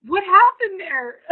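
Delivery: high, loud, slow amazed question; real amused disbelief; incredulous. Real, not acted. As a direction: pitch it up in disbelief. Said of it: "amused outburst".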